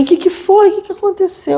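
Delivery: alarmed mother. Retold or imitated. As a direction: rush in alarmed, asking what happened. Mother bursting in after scream.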